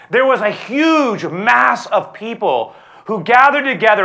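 high, loud sermon build; fiery preaching. Acted. Pastor describing Jesus entering Jerusalem, fiery preaching. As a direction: preach Palm Sunday with full force.

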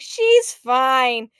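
loud, impatient mom voice; mock-exasperated insistence; dismissive mom. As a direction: brush it off loudly, imitating mom. Mom dismisses dad's worry.

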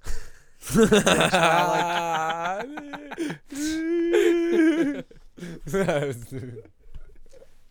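long, high wheezing laugh; crying with laughter. Real, not acted. Cracks up at dicks joke.

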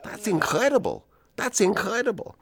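astounded. Retold or imitated. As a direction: gasp it out in disbelief. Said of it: "looks astounded and he says".

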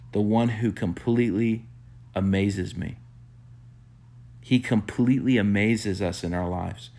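ordinary talk

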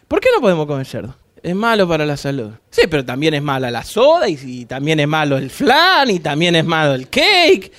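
low, imitated back-and-forth voices; preacher voicing a skeptic questioning the pork rule, pitched low; mock debate. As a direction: act out someone arguing against the pork rule. Imagined dialogue about eating pork.

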